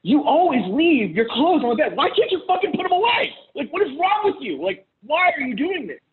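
high-pitched exasperated re-enactment; annoyed rant. Retold or imitated. Husband complaining to ex-wife about clothes on the bed, acted annoyed. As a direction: act out fed-up nagging, pitch climbing sharply. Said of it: "I'm getting annoyed as fuck".

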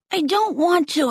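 put-on a lightly babyish, whiny refusal; boy to his parents, a touch babyish and pleading; babyish whine. A narrator's reading. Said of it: "I made my voice sound a little babyish".

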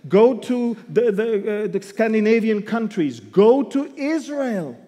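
loud, rising preacher's list; fervent pulpit roll call; preaching. Acted. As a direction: hammer each country home like a preacher.

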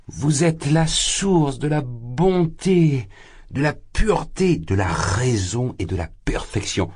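very slow, high, drawn-out rapture; exalted cry. Acted. Drunk suitor praising the sister, slow exalted rapture. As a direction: savour every word in ecstasy. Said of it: "s'écria-t-il pris d'exaltation".

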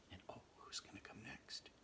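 hushed suspense whisper. Real, not acted. Asking the children who comes next, whispered. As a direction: whisper the question to build suspense.

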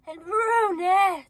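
slow, drawn-out mock-swoony "brunette"; exaggerated dreamy drawl; mocking imitation. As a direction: stretch BRUNETTE to tease the reader. Listener mocks the drawn-out reading.

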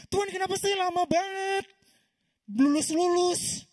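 merengek tinggi, kata terakhir ditarik pelan; doa mengeluh. Retold or imitated. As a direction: suara tinggi mengeluh seperti anak yang kesal. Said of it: "doa yang saya kasih ke Tuhan tuh kayak gini". (mahasiswa merengek kepada Tuhan, tinggi dan pelan)

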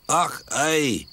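put-on drowsy Scottish accent, muttered; sleepy Scottish mutter. A narrator's reading. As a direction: mumble it half asleep, Scottish. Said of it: "muttered Donald sleepily".

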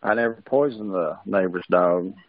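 normal talking voice

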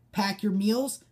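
ordinary talk

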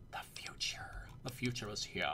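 hushed echo; mock-dramatic whisper. Gamer echoing his plan for effect, mock-dramatic whisper. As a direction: whisper it like a grand prophecy.

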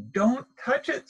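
high, lightly voiced mother warning; mother penguin. A narrator's reading. Mother warns little penguin, high caution. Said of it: "says his mother".

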